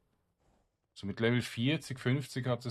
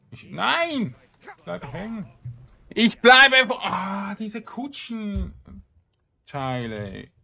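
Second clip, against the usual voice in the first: very loud, high cries of frustration; real gamer rage, slowing at the end; frustrated yelling; 11 semitones higher, 13 dB louder, much slower than usual. Real, not acted. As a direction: scream at the stuck character. Character gets stuck on carriage.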